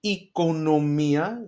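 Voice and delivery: Economia slowly, the first vowel an E sound; slow model word. Shown as a demonstration. Teacher modelling economia for students, slow and deliberate. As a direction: stretch out Economia syllable by syllable. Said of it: "you see what I did there?".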